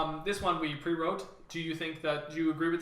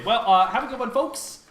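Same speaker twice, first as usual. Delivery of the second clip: ordinary talk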